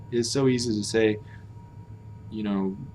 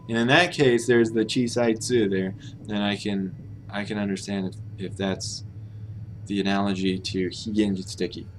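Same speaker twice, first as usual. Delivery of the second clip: ordinary talk, nothing marked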